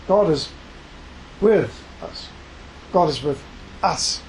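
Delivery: God, is, with, us, each word alone with pauses between; four separate stressed beats. Shown as a demonstration. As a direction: break God is with us into four hammered words. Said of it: "It's four words, but each one needs to be emphasized".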